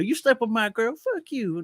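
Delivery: mock talk show fight. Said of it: "Jerry Springer".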